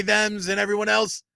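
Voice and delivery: usual speaking voice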